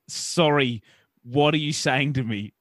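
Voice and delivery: slow it down for the confused listener. Retold or imitated. To the servo guy, repeating the question slowly. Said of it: "And I slow down again".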